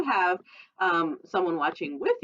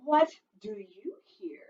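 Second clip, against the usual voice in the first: whisper; 3 semitones higher than usual. Acted. Whispered line in story.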